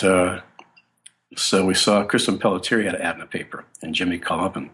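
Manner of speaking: ordinary talk, nothing marked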